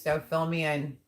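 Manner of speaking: usual speaking voice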